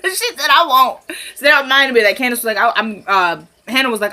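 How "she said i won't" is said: laughing as you quote it, higher pitch; loud, giggly mock quote; amused retelling. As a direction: crack up repeating a blunt comeback. Cracking up repeating the blunt quote.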